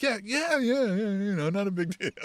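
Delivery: high, repeated mock-casual yeahs; flustered brush-off. Estranged friend pretends it is no big deal, flustered brush-off. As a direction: play it down in a high, soft imitation.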